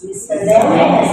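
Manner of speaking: Miscellaneous said slowly, loud and high, stress on LEN; teacher model word. Shown as a demonstration. Teacher models Miscellaneous for class, stressing LEN. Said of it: "Nah, dengar".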